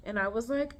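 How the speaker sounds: normal talking voice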